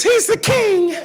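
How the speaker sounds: put-on high, squeaky kid answering teacher; child's voice. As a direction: pipe it up like an eager child. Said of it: "second grade classroom".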